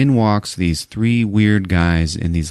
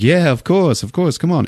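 higher-pitched mock British accent; breezy British agreement, pitched up; Seth MacFarlane; 9 semitones higher than usual. British celebrity agreeing to a selfie, breezy British accent. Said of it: "he's playing it British".

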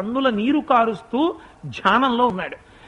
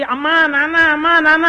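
put-on a scared boy calling out for mother and father, higher and louder; frightened child calling; 6 semitones higher, 5 dB louder than usual. Frightened boy in the temple calls out to his divine parents. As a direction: call for amma and nanna in rising fear.

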